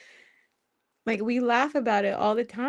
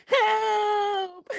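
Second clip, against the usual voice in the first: mock cry for help; 11 semitones higher than usual. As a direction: wail it like a dramatic damsel. Mock plea to God in bed.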